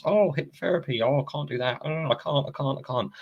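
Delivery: high mock whine of oh I can't; hammed-up helpless whining; whiny refusal. Mocking someone who refuses hypnotherapy, high whiny voice.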